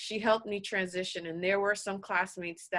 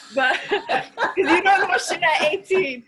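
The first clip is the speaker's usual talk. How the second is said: laughing through the line, pitched up; 9 semitones higher, 6 dB louder than usual. Real, not acted. Laughing at her own advice to her son. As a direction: laugh it out as you deliver the punchline.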